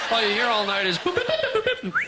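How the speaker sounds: chirpy R2-D2 style boop sounds; robot beeping. Pretend. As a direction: mimic a droid's beeps. Imitating gadget beeps.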